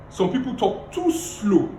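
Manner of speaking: slow, drawn-out talk; too slow. Shown as a demonstration. Coach demonstrating slow pace to the class. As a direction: drag the words out slowly. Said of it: "The second thing is pace".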